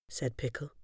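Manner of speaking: ordinary delivery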